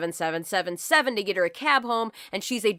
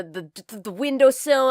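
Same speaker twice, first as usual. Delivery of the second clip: plain reading voice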